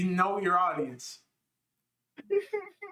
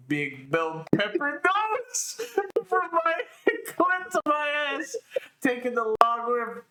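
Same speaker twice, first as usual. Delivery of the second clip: laughing while reading; 11 semitones higher than usual. Real, not acted.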